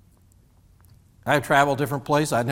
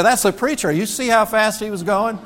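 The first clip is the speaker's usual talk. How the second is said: higher, louder teasing gossip; mock onlooker gossip; 8 semitones higher, 4 dB louder than usual. Townsfolk spotting the speeding preacher, teasing. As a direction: pitch it up like a nosy onlooker. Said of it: "They say".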